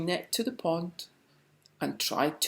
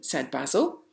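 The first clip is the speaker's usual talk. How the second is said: ordinary delivery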